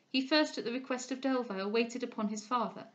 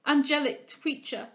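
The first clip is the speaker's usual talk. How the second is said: louder, higher cry through tears; tearful cry; 5 semitones higher, 7 dB louder than usual. A narrator's reading. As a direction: burst out as the tears overflow. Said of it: "cried Delvile, his own tears overflowing".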